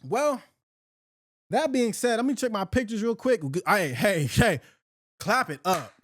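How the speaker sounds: normal talking voice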